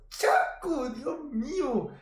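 agudo, con asombro. Real, not acted. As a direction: se maravilla de los goles. Comenta goles con asombro. (asombro agudo)

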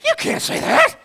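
hammed-up outraged Pharisee; mock shocked objection; scandalized Pharisee. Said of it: "doing this".

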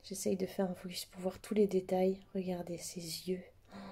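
hushed whisper while filming close. Real, not acted. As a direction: whisper it, lost in detail. Zooming on keychain details.

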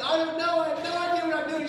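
loud, high, flustered yell; high-pitched comic desperation; mock panic. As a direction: blurt it out in hammed-up panic. Panicked answer to her, retold.

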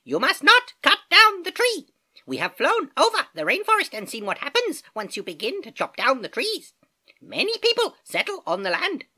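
very high, loud squawk; shrill bird cry to get attention; squeaky toucan. Acted. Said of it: "squeaked the toucan".

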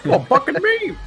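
high laughing cry; laughing shriek. Real, not acted. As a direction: squeal it through laughter.

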